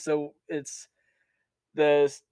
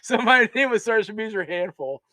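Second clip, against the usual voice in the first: laughing through the punchline; real laughter breaking in; 6 semitones higher, 5 dB louder than usual. Real, not acted. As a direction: giggle the words out.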